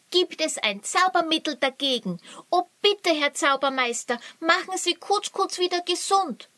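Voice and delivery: hoch, laut und schnell flehend; bettelnd. Acted. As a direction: bettelt verzweifelt. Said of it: "bettelte Luri". (Luri fleht den Zaubermeister an)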